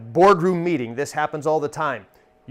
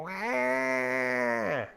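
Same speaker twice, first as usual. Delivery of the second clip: long drawn-out mock baby wail; imitated baby cry; 3 semitones lower, 7 dB quieter than usual. Comedian mimicking a baby in the theater, drawn-out wail. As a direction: wail like a baby, held on one note. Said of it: "you'll hear a baby go".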